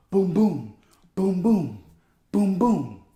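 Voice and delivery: loud, booming double thumps; punchy "boom, boom" sound effect; imitated heartbeat.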